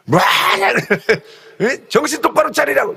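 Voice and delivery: 화를 내며 웃음 섞어 받아치듯; mock angry retort. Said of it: "뭐 이러고서는 화를 내죠". (웃음 섞인 흉내 낸 화)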